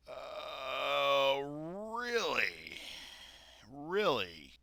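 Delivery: high, drawn-out incredulous question; sarcastic disbelief. Real, not acted. Reactor scoffing at her investment advice, sarcastic disbelief.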